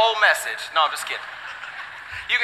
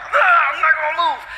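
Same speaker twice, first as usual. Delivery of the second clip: higher mock baby whine; hammed-up higher baby protest; baby throwing a fit; 5 semitones higher than usual. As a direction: play the stubborn baby in the car seat. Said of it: "back arch where he's like".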